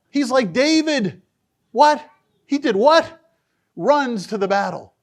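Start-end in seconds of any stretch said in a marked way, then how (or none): 1.7-3.2 high, loud incredulous what; mock astonishment. Preacher marvelling at David running to battle, loud and incredulous. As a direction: sound amazed at David.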